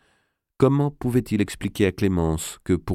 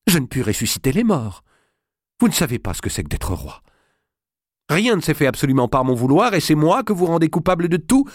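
building from bitter to shouting; rising anger; 7 semitones higher, 3 dB louder than usual. Acted. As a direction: voice climbing higher and louder, exasperated king. Louis reproaches wife, rising anger. (roi exaspéré qui monte le ton contre sa femme)

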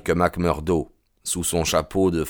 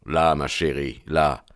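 lower, tender reassurance; soothing; 4 semitones lower, 4 dB quieter than usual. Acted. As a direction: gently hush a worried sweetheart. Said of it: "la poussa doucement vers une chaise". (apaisant et tendre, voix plus basse)

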